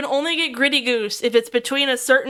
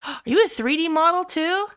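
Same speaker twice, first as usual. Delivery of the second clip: usual speaking voice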